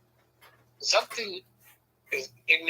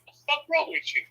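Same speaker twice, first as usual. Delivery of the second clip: much higher-pitched disbelief; mock incredulous outburst; 12 semitones higher than usual. Husband freed from headlock asks wife, high mock disbelief. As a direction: question in a high, put-on squeal.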